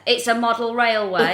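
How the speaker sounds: loud mock outrage; indignant correction. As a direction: imitate an affronted hobbyist snapping back. Retelling bloke's outraged correction.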